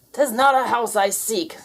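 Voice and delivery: snarling ghost pirate. Acted. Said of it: "snarled Blackbeard".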